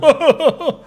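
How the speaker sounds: sharp high laugh; high squeal of laughter. Real, not acted. Streamer laughing at his own Tate joke, high squeal.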